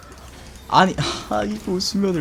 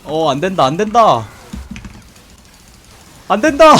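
rising from alarm to desperate yell; no no no, louder each time; panicked shout; 5 semitones higher, 8 dB louder than usual. Real, not acted. Teamfight going wrong, begging.

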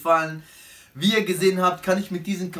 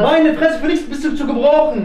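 angry; 8 semitones higher, 7 dB louder than usual. Acted. Acted quarrel, insulting roommate.

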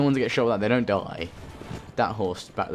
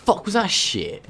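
high, loud disbelief; shocked outburst; 11 semitones higher, 5 dB louder than usual. Real, not acted. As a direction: blurt out in shock. Reacting to weird voice.

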